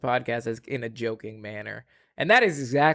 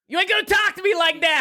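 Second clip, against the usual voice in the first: New Yorker. Pretend. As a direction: bark it like a street New Yorker. Mock tough New Yorker voice.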